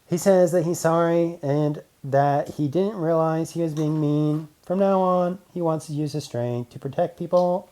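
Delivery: robot man voice. Acted. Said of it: "Robot Man translated".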